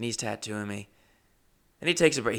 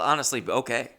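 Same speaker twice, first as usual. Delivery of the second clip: ordinary delivery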